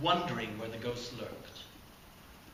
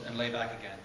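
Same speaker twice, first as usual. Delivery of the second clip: ordinary delivery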